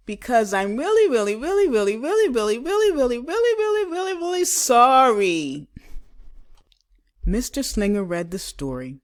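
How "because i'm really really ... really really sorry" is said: high, loud, ever-climbing pleading; gushing apology. Acted. Girl begging her teacher's forgiveness, high and gushing. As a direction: pile on each really higher and louder.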